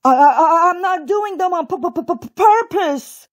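high, sputtering stutter; stammered protest. A narrator's reading. Poor bunny denying the tricks, high stammering. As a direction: stutter defensively on the first sounds.

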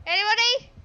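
high loud call for help; desperate call. Real, not acted. Streamer shouting for a teammate to heal him, high desperate call. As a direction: shout it across the map, pleading.

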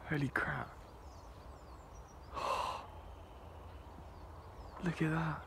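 ordinary talk, nothing marked

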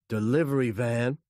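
short, loud message; radio call. A narrator's reading. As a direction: call it in over radio noise. Heard over a noisy radio.

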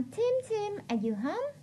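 high-pitched mock call to a child; acted motherly call, rising at the end; mom calling her son. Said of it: "Gọi bạn, bạn Tim nè".

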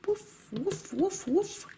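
high, soft playful woof; high, soft puppy woofs; puppy barking. Acted. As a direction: bark like a cute little toy dog. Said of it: "I'm a doggy".

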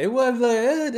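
ordinary talk

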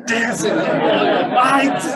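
high, loud exuberant shout; fired-up shout. Acted. Preaching about David dancing, high loud exuberant shout. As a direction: belt it out like you're dancing.

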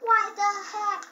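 high, loud why the heck with WHY punched; exasperated yell. Real, not acted. Player shouting at the game in exasperation. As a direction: throw it at the screen.

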